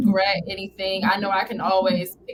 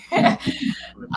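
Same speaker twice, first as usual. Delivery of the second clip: breathy laugh. Real, not acted. Laughs after calling New York brutal.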